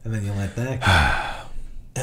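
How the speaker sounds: low, very slow and drawn out; slow release. Shown as a demonstration. Meditator showing how thoughts are let go, slow and low. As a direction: ease the words out as if releasing a thought.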